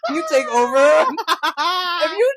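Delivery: shouting through laughter; laugh-yelling, mouth full. Real, not acted.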